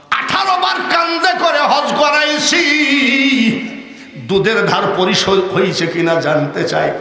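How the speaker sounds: high, ringing singsong declamation; full-force chanting sermon; impassioned preaching. Acted. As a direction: build to a long chanted wail at the end.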